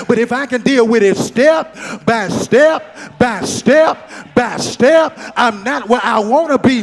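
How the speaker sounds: loud, driving preacher cadence, punching key words; full-force preaching. Real, not acted. Preacher urging the congregation on step by step, fired-up preaching. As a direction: preach it up to a shouted peak. Preaching builds to a shouted climax.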